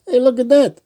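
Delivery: plain reading voice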